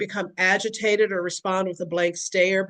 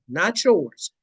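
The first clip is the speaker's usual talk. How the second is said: ordinary delivery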